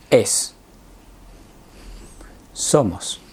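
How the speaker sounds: each form set apart by long pauses; spaced classroom recitation; slow verb listing. Shown as a demonstration. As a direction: lay the forms out one at a time. Slow list of verb forms.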